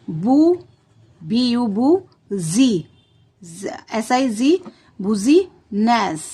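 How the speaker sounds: letters spelt slowly, then बुजीनेस as "bu-zee-ness"; slow spelling out. Shown as a demonstration. Teacher spells business for children, slow and clear. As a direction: sound out each chunk slowly. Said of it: "अब देखिए कैसे लिखेगा".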